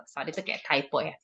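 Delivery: normal talking voice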